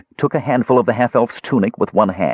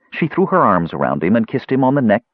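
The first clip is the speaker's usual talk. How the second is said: ordinary delivery, nothing marked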